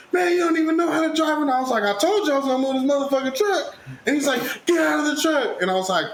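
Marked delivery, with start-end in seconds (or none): 0.1-1.4 high-pitched mock protest; retold driver's complaint. Acted quarrel over the truck. 1.8-3.8 higher-pitched acted comeback; mock-indignant retelling of a retort; retold defiant retort. Acted quarrel over the truck. 4.7-5.6 high-pitched mock command; acted demand, pitched high; retold angry order. Acted order to leave the truck.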